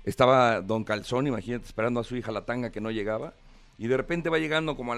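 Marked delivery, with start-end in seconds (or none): none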